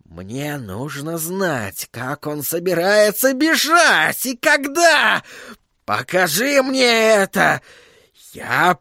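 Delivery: slow, building to high insistence; furious demand. A narrator's reading. Sorcerer demanding to know the escape, furious.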